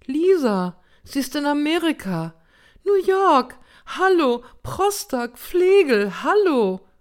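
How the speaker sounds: loud, high distraught shouting; high-pitched, agitated calls for attention; desperate. A narrator's reading. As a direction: cry out frantically, word after word. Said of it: "Oma klang aufrichtig verzweifelt".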